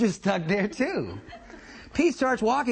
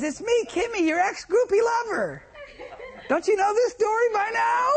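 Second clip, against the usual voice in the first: Kimmy's voice; 13 semitones higher than usual. Retold or imitated. Ex calling Pete over from across the street, high coaxing voice. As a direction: pitch way up and coo at him. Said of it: "And she says".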